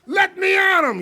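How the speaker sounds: very high, very loud shouted challenge; drunk battle cry. Retold or imitated. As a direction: scream to get at the fight. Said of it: "And he's going".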